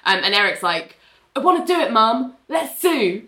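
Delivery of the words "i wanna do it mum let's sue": imitating an eager boy, high-pitched. Eric telling his mum he wants to sue, eager. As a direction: plead keenly in a raised voice.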